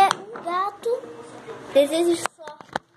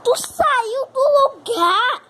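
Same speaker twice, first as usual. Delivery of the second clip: slow scolding; 6 semitones higher, 7 dB louder, much slower than usual. Real, not acted.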